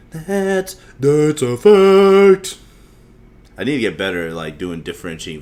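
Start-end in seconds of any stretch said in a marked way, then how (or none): 0.1-2.6 loud, high, hugely drawn-out mock declaration; hammed-up proclamation. Host repeating his own catchphrase, hammed-up loud. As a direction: stretch each word slowly and blare it out.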